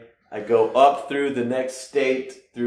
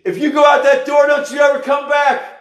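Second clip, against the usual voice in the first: mom's furious shout; 7 dB louder than usual. Retold or imitated. Mom yelling as he leaves.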